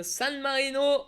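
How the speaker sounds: put-on slow, drawn-out English accent on the name. Hamming an English accent while reading the name to the chat. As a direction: say it the English way, stretched. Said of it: "j'ai un accent anglais".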